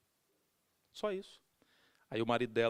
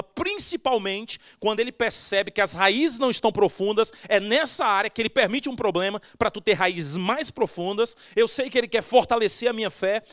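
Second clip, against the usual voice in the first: loud, driving sermon delivery, each phrase hammered out; preaching at full force; 7 semitones higher, 12 dB louder than usual. Acted. Pastor preaching to the church, loud and forceful. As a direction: preach it hard to the whole congregation.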